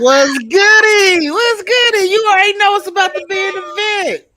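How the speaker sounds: [name] impression. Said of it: "I gotta do my [name] impression".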